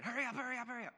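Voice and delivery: high, loud, repeated urging; urgent lookouts. Retold or imitated. Lookouts on either side urging the robber on, high mock urgency. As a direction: imitate jumpy lookouts rushing their friend. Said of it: "they were, like, saying".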